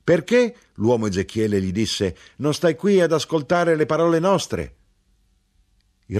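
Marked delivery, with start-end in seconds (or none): 0.0-4.8 put-on high, loud reproach to a child; chiding uncle. A narrator's reading. Ezechiele asks his nephew why he isn't listening, high chiding. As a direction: scold the boy for not listening.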